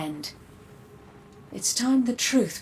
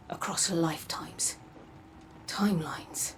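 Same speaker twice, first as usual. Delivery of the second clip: hushed, unvoiced whisper; 7 dB quieter than usual. Guide hinting at other timelines in a whisper. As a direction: let the words fade into a secret. Mysterious guide addressing Guardian.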